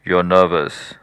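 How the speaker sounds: the mind pointing it out; a hint of an accusing inner voice. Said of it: "it says".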